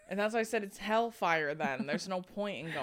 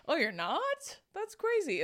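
mocking a surprised stranger, pitch jumping far higher; mock surprise; 10 semitones higher than usual. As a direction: imitate a clueless, shocked college kid. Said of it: "And they'd be like".

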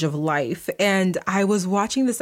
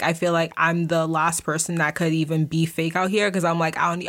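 ordinary talk, nothing marked

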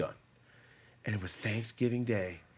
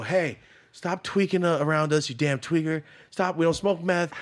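retold scolding; 7 semitones higher, 7 dB louder than usual. Scolding the woman by the fence, loud and angry. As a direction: scold her hard.

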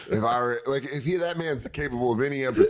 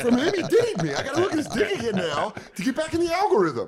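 mock outraged disbelief; 14 semitones higher than usual. Comic complaint about the algorithm, high laughing outrage. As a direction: squeal in fake indignation.

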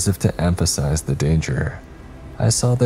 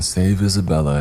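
ordinary delivery, nothing marked

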